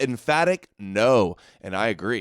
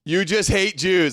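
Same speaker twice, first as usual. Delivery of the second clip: high, louder mocking parrot of her line; mocking echo; 7 semitones higher, 4 dB louder than usual. Streamer mocking the debater's accusation, high and louder. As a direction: sneer it back like a mimic. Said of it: "That's what they always do".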